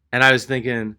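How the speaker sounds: ordinary talk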